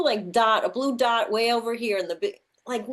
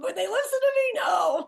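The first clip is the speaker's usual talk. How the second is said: exasperated shout; 11 semitones higher than usual. Acted. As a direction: vent it like a fed-up parent. God complaining about humans.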